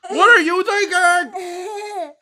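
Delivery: high, slow indignant cry; fussy book character. Acted. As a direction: demand it of the reader. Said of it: "It says".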